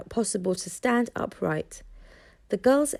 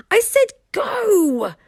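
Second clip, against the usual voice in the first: high, loud and slow in frustration; frustrated repeat; 12 semitones higher, 7 dB louder, slower than usual. A narrator's reading. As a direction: repeat the order, exasperated and loud. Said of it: "Hiccup repeated in frustration".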